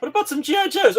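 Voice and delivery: very high, loud coaxing question; hammed-up high parent voice; mock relative voice. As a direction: squeak it out like a pushy relative. Family offer, mocked.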